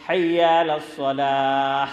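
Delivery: slow chant wrongly closing "الصلاح" on a strong throaty ح; mistaken big ha. Retold or imitated. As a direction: imitate a mistaken reciter, drawing out "الصلاح" into an audible h. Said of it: "اس لیے چودھری صاحب وہ کہتے ہیں".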